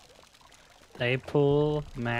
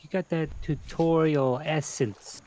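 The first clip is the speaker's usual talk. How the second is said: put-on New York accent; 5 semitones higher than usual. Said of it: "in his New York accent".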